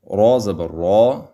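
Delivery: را زبر را with a full, heavy, rounded raa; heavy throaty را in را زبر را; thick heavy ra. Shown as a demonstration. Teacher showing kids the heavy ra sound in را زبر را. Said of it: "زبر ہوئی تو اس کو پر پڑھیں گے".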